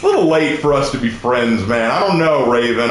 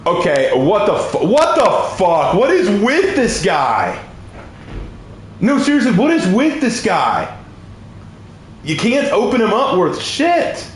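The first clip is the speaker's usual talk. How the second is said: exasperated; 5 semitones higher than usual. Real, not acted. Fighting a player who won't open up, exasperated. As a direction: stretch the words in disbelief at the opponent.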